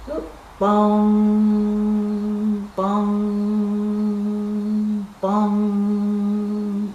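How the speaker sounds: long ringing bong like a church bell. Acted. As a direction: let each bong hang like a big bell. Church bell rings under nest.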